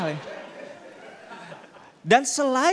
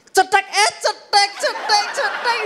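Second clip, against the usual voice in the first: high, loud startled typing sounds; latah typing; 12 semitones higher, 4 dB louder, slower than usual. Pretend. Latah woman typing and startled at every key, high yelps. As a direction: jump at each keystroke. Mimicking typewriter, latah style.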